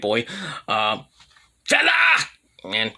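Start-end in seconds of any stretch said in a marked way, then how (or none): 1.6-1.9 sudden shouted catchphrase; mock wrestler's call; mimicked catchphrase. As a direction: blurt it out as a put-on catchphrase.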